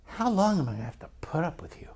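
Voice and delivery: hushed, rapid mock-exasperated whisper; whispered parental exasperation, rushed; frustrated mom. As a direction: imitate a fed-up mom whispering fast. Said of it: "they would get frustrated".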